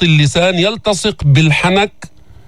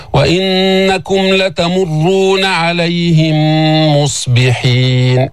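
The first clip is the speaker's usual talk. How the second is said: slow, sustained tajweed recitation; Quran recitation; much slower than usual. Shown as a demonstration. Teacher recites the verse for learners, slow recitation. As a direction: hold the hums and long vowels.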